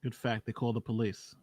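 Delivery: low, soft musing echo; quiet aside. Real, not acted. Musing aside echoing the lawyer.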